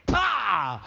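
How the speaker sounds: explosive vocal thwack; imitated smack "pah". Comic imitates butcher's kick landing, punchy "pah". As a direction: puff out a sharp slap noise.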